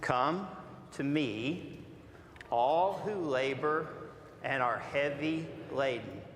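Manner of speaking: slow, measured reading aloud with the congregation; slow group reading. Shown as a demonstration. Pastor leading the church in slow scripture reading. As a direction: read each phrase slowly, pausing between. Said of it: "read slowly and out loud together".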